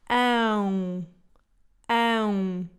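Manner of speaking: ão with air through the nose, lips not fully closed; a nasal ão, trailing out. Shown as a demonstration. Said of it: "Têm que dizer ão".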